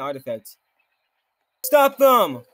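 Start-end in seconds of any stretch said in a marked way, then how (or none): 1.6-2.4 shouted order. Acted. NPC orders capture.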